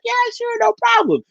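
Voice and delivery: high mock salesman voice, retold; [name] voiced high. Telling how the salesman answered him, in a high put-on voice.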